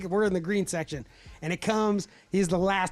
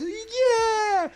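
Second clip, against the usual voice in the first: very high ringing cheer; knights' war cry, hammed-up; battle cry; 4 dB louder than usual. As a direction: whoop like a charging rider.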